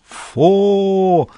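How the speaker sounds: high, ringing ceremonial call; solemn loud cry. Acted. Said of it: "громкий и торжественный возглас".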